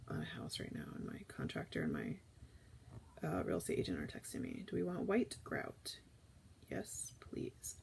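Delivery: soft unvoiced whisper to herself; whispered aside. Real, not acted. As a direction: whisper it off to the side. Whispered aside while texting.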